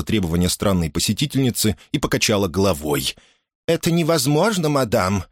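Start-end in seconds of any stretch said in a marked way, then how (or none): 3.7-5.2 higher-pitched, firm refusal; no room for argument; unyielding. A narrator's reading. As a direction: refuse as an adamant housekeeper. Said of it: "непреклонным тоном заявила домоправительница".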